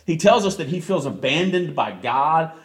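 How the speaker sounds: ordinary delivery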